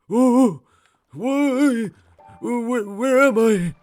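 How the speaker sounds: put-on high, loud, quavering old man. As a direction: play a confused ancient elder. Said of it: "doing the old man voice".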